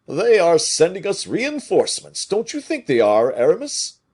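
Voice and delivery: high, loud hopeful giant; a big soldier voiced high and loud, full of hope; eager Porthos. A narrator's reading. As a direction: call out the good news eagerly.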